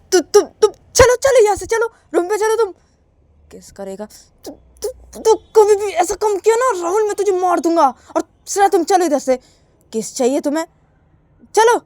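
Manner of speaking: high, loud angry orders and threat; furious sister. Acted. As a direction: drag her away in a rage. Shruti drags Shreya away.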